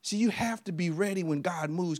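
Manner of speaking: normal talking voice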